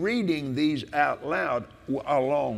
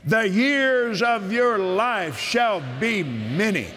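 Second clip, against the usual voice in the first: preaching at full force; 5 semitones higher, slower than usual. Acted. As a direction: shout the promise like from the pulpit.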